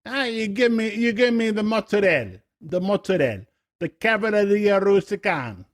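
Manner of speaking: high, sing-song mock Jersey-Italian; New Jersey Italian accent. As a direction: order like a cartoon Jersey Italian, final vowels dropped. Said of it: "That's New Jersey. It's like".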